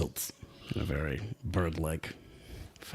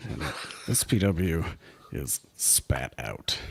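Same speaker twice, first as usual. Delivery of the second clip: normal talking voice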